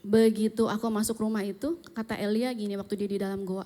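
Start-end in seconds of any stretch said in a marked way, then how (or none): none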